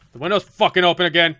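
loud, sweary burst of disbelief; exasperated outburst. Real, not acted. Gamer reacting to the window open again, exasperated outburst. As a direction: blurt it out loudly, exasperated.

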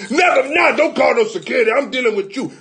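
high, loud, laughing protest; laughing panicked shout. Acted. Customer begging the chiropractor not to call security, loud and laughing.

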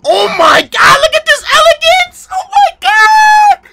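high-pitched hype scream, shouting; excited screaming. Real, not acted. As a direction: scream at the fight like it's the best thing ever. Hype reaction to anime fight.

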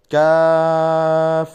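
কাফ drawn very long and loud; light Arabic letter. Shown as a demonstration. Teacher leading students to repeat কাফ. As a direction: stretch কাফ out slowly. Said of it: "জিহ্বার গোড়া হতে একটু আগে".

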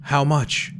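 high-pitched, frightened little question; shivering fear. A narrator's reading. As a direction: ask it high, trembling at the knife. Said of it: "with a shivering look at the knife".

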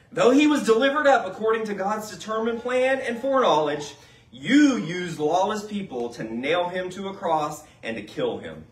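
scripture reading. Acted. Reads scripture aloud in sermon.

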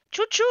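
high, loud choo-choo call; playful choo-choo. Pretend. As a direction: play the little train. Imitates a train.

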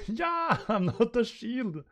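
high-pitched scream of protest; panicked protest. Acted. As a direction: shriek it as a human shield. Used as a human shield.

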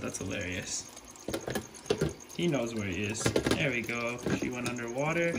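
soft hushed voice, almost whispered. Real, not acted. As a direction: talk softly so the animals stay calm. Releasing lizards, hushed voice to them.

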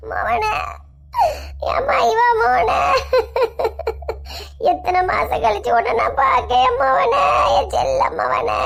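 slow, wailing cry over her son; crying mother. Acted. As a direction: sob out the greeting, dragging each word. Mother tearfully greets estranged son. (அழுகையுடன் மெதுவாக ஓலமிடும் தாய்)

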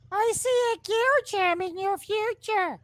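high mystical fortune teller voice; psychic hotline. Pretend. Psychic take of same line.

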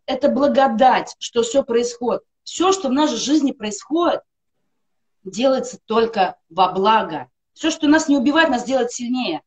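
ordinary talk

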